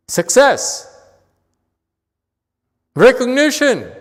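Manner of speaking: high, loud single-word calls; a master calls out what people crave, loud and high. Acted. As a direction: call each word out like a prize.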